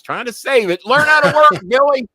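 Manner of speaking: high, very loud exclamation; exasperated yell. Real, not acted. Host scolding Billy for spoiling his announcement, exasperated yell. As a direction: yell it out, breaking into a laugh.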